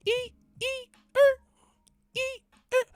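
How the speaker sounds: very high squealed noise; imitated screech. Mocking someone trying too hard, very high screech noises. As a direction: squeal it way up like skidding tires.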